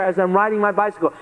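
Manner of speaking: usual speaking voice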